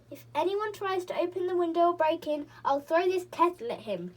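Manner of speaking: loud threatening cry; fierce shout. A narrator's reading. Moonface threatens the bears with the kettle, loud shout. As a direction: threaten them at full voice. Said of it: "shouted Moonface".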